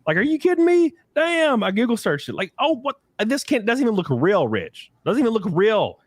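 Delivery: high-pitched disbelief; can't believe your eyes; incredulous raving. Retold or imitated. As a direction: rave in amazed disbelief, voice shooting up. Raving about her photo.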